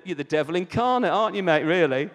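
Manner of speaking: loud, incredulous retort; mock exasperation. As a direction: accuse your mate in comic disbelief. Retold exasperated thought at passenger.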